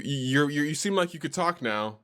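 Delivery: ordinary delivery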